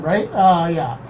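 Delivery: ordinary delivery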